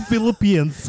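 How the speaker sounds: high, hammed-up old-country accent; playful homeland accent; mock old-country accent. Continuing old-country accent bit.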